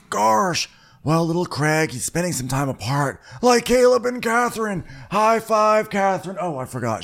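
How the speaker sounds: hammed-up high cartoonish quote; goofy character impression. Acting out Chap's dopey cheerful reply.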